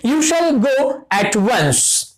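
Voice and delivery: very slow, word by word, like dictating; slow dictation. Shown as a demonstration. Teacher reading an example sentence to the class, very slow. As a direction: spell the example out slowly for students. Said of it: "तो ये sentence देखो".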